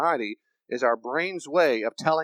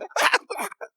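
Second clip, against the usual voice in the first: high-pitched roller coaster scream; mock scream; 4 dB louder than usual. Rider on a roller coaster, mock scream. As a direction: let out a mock terrified shriek.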